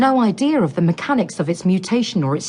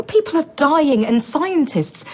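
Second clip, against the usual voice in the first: pitched up, indignant and heated; heated outburst; 7 semitones higher than usual. Acted. Scientist berating the director, heated and high. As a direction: rail against selfish scientists. Heated argument over funding.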